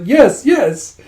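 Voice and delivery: high, loud sing-song yeses; sing-song cheering. Retold or imitated. Zeno singing at his troubled friend, sing-song cheer. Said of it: "Zeno's just singing songs like".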